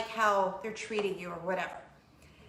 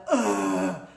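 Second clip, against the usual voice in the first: loud exasperated ugh; teen groan; 2 semitones lower, 7 dB louder than usual. Retold or imitated. Mom imitating her daughter's groan. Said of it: "when my teenage daughter does that".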